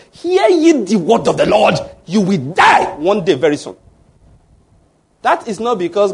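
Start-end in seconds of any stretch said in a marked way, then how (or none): 0.1-3.8 prophetic proclamation. Acted. Proclaims a prophetic warning to sinner.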